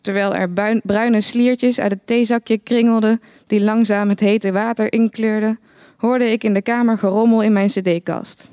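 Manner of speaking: ordinary delivery, nothing marked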